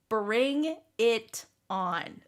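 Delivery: very slow, spaced-out dare; drawn-out challenge. Real, not acted. Friend daring others to troll her back, slow drawn-out challenge. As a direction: space each word out as a challenge.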